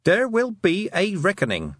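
high, proclaimed warning; threatening shout. A narrator's reading. Tiffany warns the sheep thieves, threatening shout. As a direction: declare the threat high to the sky. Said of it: "she shouted at the sky".

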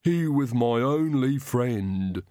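slow, odd, heavy servant's voice; Igor, slow and mournful; Igor's grieving voice. A narrator's reading. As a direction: drag each word out in grief. Igor mourns his dog.

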